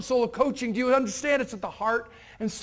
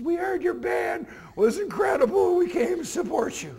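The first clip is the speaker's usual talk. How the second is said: nervous teacher; 7 semitones higher than usual. Retold or imitated. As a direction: push it out, heart pounding, voice pitched way up. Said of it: "his heart starts pounding up into his neck".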